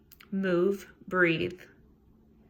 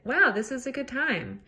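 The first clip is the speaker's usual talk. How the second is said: higher, lilting wow of delight; pleasantly wowed; 5 semitones higher than usual. Acted. Host voicing the top step of the mood ladder, pleasantly wowed. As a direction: act out arriving at a good time. Voicing the good-time state.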